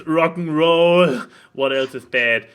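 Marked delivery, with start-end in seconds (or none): 0.1-1.2 slow, louder, drawn-out rock and roll; stretched-out rock and roll; mock rocker cheer. Mock-cheering bad habits.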